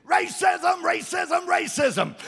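high, mocking chant of a voice in the head; sing-song it like a nagging voice; nagging inner voice. Pastor imitating a nagging inner voice, high mocking chant. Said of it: "a voice in there that's saying".

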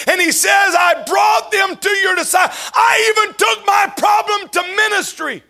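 loud, high shouted preaching; preaching at full force. Acted. Preacher shouting the father's cry to the congregation. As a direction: preach it out at the top of your voice.

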